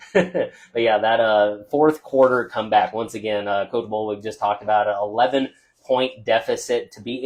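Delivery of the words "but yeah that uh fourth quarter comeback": normal talking voice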